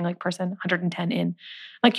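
ordinary talk

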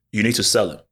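normal talking voice

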